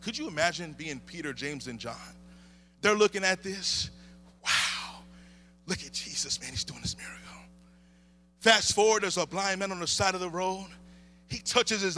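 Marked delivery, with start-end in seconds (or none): 4.4-7.2 low, hushed amazement; awed whisper. Acted. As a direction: whisper to your friend in wonder. Voices awestruck disciples watching miracle.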